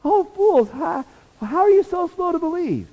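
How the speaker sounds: high, loud eye-rolling exasperation; exasperated Jesus. Retold or imitated. Jesus scolding disciples on road, exasperated high voice. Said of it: "rolling his eyes and going".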